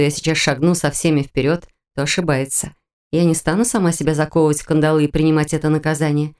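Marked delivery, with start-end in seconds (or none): none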